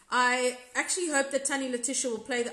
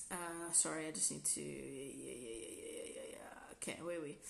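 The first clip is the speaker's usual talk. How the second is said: real whisper off-mic, much softer; whispered aside; 9 semitones lower, 14 dB quieter than usual. Real, not acted. Host whispering to someone off-mic mid-stream, hushed.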